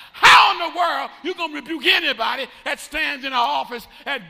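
building sermon shout; preaching. Acted. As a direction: preach it to the back pew at full force. Preaching at full force.

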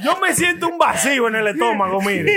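laughing. Real, not acted. Laughing while complaining of hunger.

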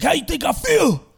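high, loud yelling ex-con; angry guest speaker shouting at students; retold shout. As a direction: bellow it at the crowd like the prison speaker did. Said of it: "He's like".

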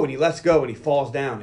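usual speaking voice

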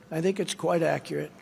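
usual speaking voice

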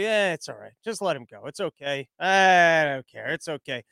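a mob boss too stuffed with salami to care; mobster voice. Retold or imitated. Stuffed mob boss waving off a blunder, in a low mobster voice. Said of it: "I don't know why I'm mixing in Trump with him".

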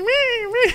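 very high imitated squeal; squealing creature. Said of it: "starts squealing".

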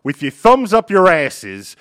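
loud, high, shouting police chief; angry police boss. Acted. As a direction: chew out your detectives. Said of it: "And you're their boss. Go".

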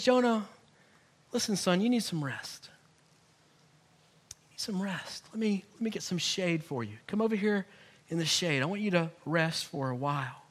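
soft, gentle fatherly voice; tender God. Retold or imitated. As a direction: soothe a tired son. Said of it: "And God says".

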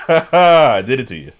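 laughing through a slow teasing line. Real, not acted. Teasing his cohost, laughing. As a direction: chuckle the words out.

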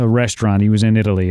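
ordinary delivery

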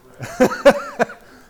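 loud, high-pitched burst of laughter. Real, not acted. Teacher cracks up after declaring them wrong, loud laugh.

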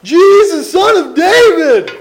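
high, loud, drawn-out cry; desperate yell. Retold or imitated. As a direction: call out slowly over the crowd. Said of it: "He's yelling, yelling, yelling".

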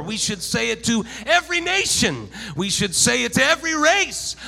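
loud, high, impassioned sermon delivery; full-force preaching. Real, not acted.

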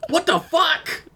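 high-pitched stunned outburst; real shock. Real, not acted. Listener reacts to the story's twist ending, shocked. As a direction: blurt it out in disbelief, voice leaping up.

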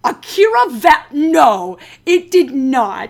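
loud, drawn-out disbelief; emphatic, stretched-out denial; incredulous. Real, not acted. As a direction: refuse to believe it, word by slow word. Mock outrage at silly name change.

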